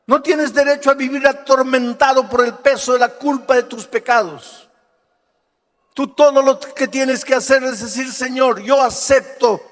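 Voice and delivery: loud, high, urgent altar call building to a shout; fiery revival preacher shouting the prayer; preaching at full force. Acted. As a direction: call the crowd to surrender, pounding every phrase.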